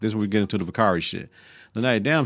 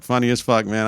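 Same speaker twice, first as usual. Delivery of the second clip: normal talking voice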